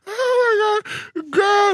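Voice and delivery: high, slow, wailing laugh; laughing cry. Real, not acted. Laughing at the memory of the gunman, high wailing cry. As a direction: let the laugh break into a cry.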